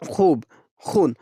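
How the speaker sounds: खूब and खून with the long oo stretched; drawn-out vowels. Shown as a demonstration. Teacher showing wav maroof, खूब and खून with oo drawn out. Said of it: "उसे खींच कर पढ़ा जाए".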